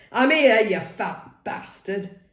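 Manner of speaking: mock the big man, cocky; cocky taunt. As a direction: goad him, grinning. Cocky taunt while dodging.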